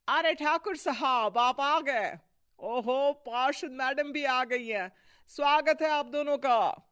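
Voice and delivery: high, loud, gushing greeting; effusive welcome. Acted. Host welcoming Thakur and councillor wife, effusive. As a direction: hail the guests with showy delight.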